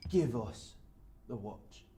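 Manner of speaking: measured mugger's order; contained threat. Acted. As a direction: demand it quietly, with menace. Mugger's first demand for watch.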